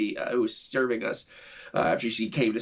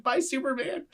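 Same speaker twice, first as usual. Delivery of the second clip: higher, cute wave-goodbye; sweet goodbye; 11 semitones higher than usual. Retold or imitated. Retelling the server waving bye to his shirt, higher. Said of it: "turned to my shirt ... waved and said".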